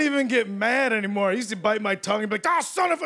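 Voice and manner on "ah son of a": sudden high yelp of pain cut short; pained curse. Retold or imitated. Young man biting his tongue, high pained curse. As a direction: yelp it like you just bit your tongue.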